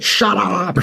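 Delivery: a high-pitched mock yell at a crying child; retold yell. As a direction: snap it up high like an annoyed parent. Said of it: "yelled at the kid".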